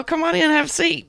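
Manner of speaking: high, grinning mock hospitality; put-on grinning welcome. Trucker welcoming the cop, all grinning, put-on friendly. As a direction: play the eager host with a grin. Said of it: "I was all grinning and shit".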